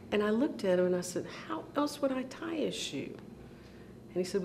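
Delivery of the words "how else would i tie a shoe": put-on small, high, soft child's voice; bewildered little kid. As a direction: imitate a puzzled five-year-old. Child baffled by her brother's question.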